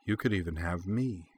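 soft, seductive offer. A narrator's reading. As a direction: drop the volume and offer it intimately. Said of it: "added in a lower, softer voice".

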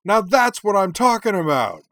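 high, loud whoop of approval; exultant sportscaster yell; excited shout. A narrator's reading. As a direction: holler it out, thrilled, letting the end slide down. Commentator exults over the play.

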